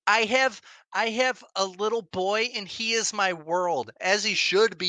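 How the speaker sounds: high, mocking dating-profile mom. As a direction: sneer it like a bragging mom.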